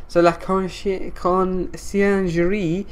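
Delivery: slow, careful spelling-out of Conciergerie; carefully sounding out a French name. Shown as a demonstration. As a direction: drag out Conciergerie syllable by syllable. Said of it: "So La Conciergerie".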